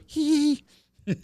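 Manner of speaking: high tight little giggle; self-conscious giggle. Shown as a demonstration. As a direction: hold back a laugh. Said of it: "so I go".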